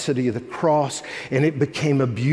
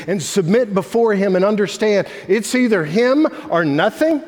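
preaching at full force; 4 semitones higher than usual. Acted. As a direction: drive the point home to the congregation. Preaching the point.

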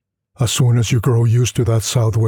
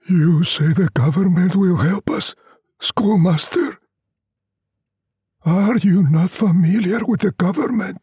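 higher, lightly voiced weary villager, wry; old peasant doubting the schoolmaster, a bit higher; Luvina villagers; 10 semitones higher than usual.

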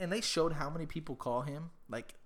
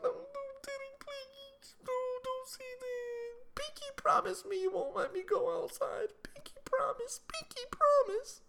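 put-on very high, squeaky child's begging; imitated pleading daughter. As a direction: whimper and beg like a terrified little kid, hammed up. Voicing daughter begging for promise.

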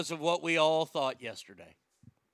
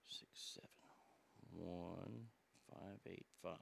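hushed whisper; 7 semitones lower, 13 dB quieter than usual. Acted. Hushed setup before a prank call.

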